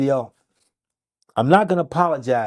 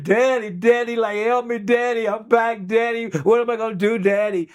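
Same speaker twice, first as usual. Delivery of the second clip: mock scream for daddy; 8 semitones higher than usual. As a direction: beg your dad for help in a raised voice. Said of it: "And she was screaming".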